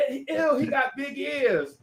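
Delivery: high slow taunt; mocking girls. Friends mocking the boy's ears, high and slow. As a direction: sneer at him slowly. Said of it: "her friends like".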